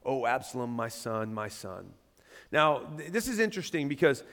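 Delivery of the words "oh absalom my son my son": low, soft grieving reading; understated crying lament. A narrator's reading. King mourning his son Absalom, subdued lament. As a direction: mourn quietly, sinking lower to the end. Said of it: "he cried".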